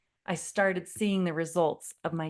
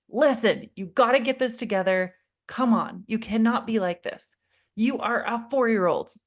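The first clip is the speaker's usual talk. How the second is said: louder, higher stern scolding; irritated lecture; 4 semitones higher, 5 dB louder than usual. Retold or imitated. Mom losing patience with son, louder stern scold. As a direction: scold him to pull it together. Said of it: "lecturing and I'm getting pissed".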